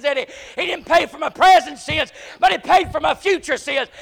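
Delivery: ordinary talk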